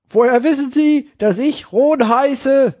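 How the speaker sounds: joke voice. Acted. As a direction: play the puzzled gentleman, slowly. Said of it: "Er antwortet". (hohe, langsame Witzstimme)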